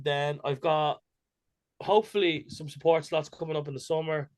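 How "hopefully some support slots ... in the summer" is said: usual speaking voice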